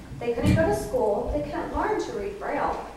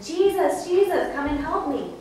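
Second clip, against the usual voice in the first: high, raised imitated shout; shouting louder; 5 semitones higher, 5 dB louder than usual. Blind Bartimaeus refusing to be hushed, shouting. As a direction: call out insistently for help. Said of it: "He shouted louder".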